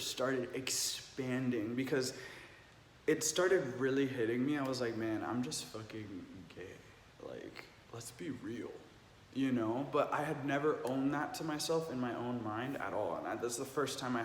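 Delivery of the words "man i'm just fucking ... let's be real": quiet inner thought. Retold or imitated. Inner thought voiced softly.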